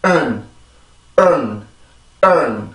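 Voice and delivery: 은 ending very short and weak; drilled "earn" ending. Shown as a demonstration. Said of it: "다시 해봅시다".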